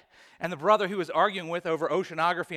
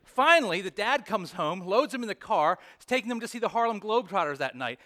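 usual speaking voice

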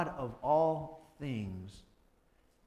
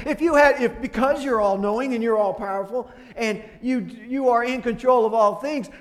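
usual speaking voice

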